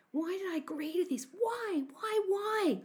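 high-pitched, panicky wail; anguished regret. A narrator's reading. Boy regrets agreeing to steal.

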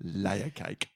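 mock English accent, dropping low at the end; shithouse English accent. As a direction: give the film title a bad English accent. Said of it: "in an English shithouse accent".

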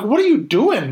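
high-pitched pleading disbelief; exasperated protest. Retold or imitated. Fan to the show's writer, exasperated. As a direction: plead with someone who should know better.

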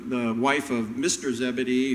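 normal talking voice

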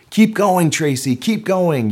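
first base coach yelling encouragement; yelled. Retold or imitated. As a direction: urge her on from first base. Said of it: "yelled the first base coach".

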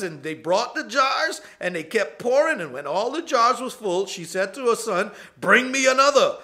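the prophet's orders preached with rising fervor; full-force preaching. Acted. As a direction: drive the story home from the pulpit. Preaches the scripture story at full force.

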